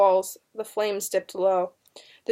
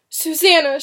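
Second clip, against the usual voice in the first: raised, urgent mother's warning; warning call; 7 semitones higher, 7 dB louder than usual. A narrator's reading. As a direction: call her name to stop her. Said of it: "could hear her mother's warning".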